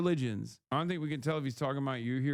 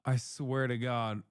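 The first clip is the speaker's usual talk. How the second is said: ordinary talk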